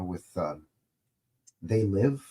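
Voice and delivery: ordinary talk, nothing marked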